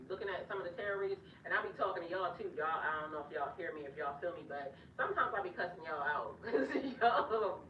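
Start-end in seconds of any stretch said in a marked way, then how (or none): 6.4-7.6 through a laugh. Real, not acted. Confessing to cussing out YouTubers, laughing. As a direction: crack up as you say it.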